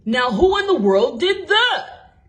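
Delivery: loud, gruff night watchman; a watchman startled by a crash; exclaiming watchman. A narrator's reading. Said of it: "he exclaimed".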